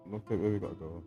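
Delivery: ordinary delivery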